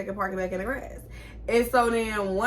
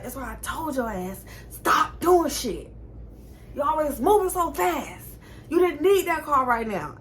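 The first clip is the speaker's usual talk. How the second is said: scolding mom; 6 semitones higher than usual. Retold or imitated. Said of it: "She's like".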